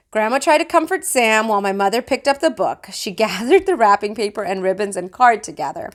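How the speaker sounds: plain reading voice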